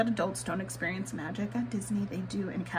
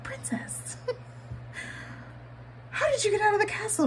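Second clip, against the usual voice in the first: high, sweet, hurried fairy-tale question; cast member voice; 12 semitones higher than usual. Retold or imitated. Retelling the cast member's greeting, high and sweet. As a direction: rush it like a storybook courtier. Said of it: "he was like".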